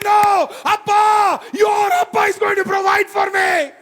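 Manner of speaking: high, loud shouting, slowing at the peak; shouted preaching climax. Acted. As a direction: shout the promise to the whole church.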